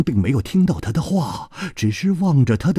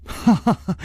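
轻笑; 6 semitones higher than usual. A narrator's reading. 楚留香笑.

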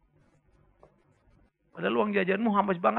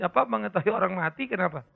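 normal talking voice

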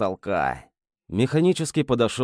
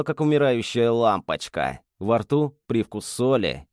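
ordinary delivery, nothing marked

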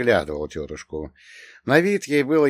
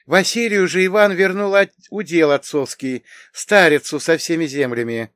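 higher, louder woman's character voice; emphatic high-voiced aunt telling a tale; reminiscing aunt; 7 semitones higher, 6 dB louder than usual. A narrator's reading. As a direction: keep recounting family history, pitched high. Aunt continues recounting in character.

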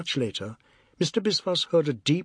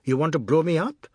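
slightly raised, teasing complaint; jocular anger; 3 semitones higher, 4 dB louder than usual. A narrator's reading. Husband to wife over the starch, jocular anger. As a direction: grumble as a joke. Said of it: "but he spoke jocularly".

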